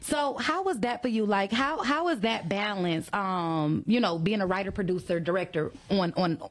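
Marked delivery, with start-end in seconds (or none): none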